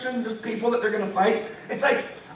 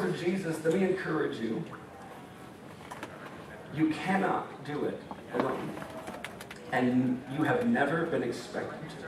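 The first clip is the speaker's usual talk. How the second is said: tender encouragement; 7 semitones lower, 9 dB quieter than usual. Real, not acted. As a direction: hush down and reassure them.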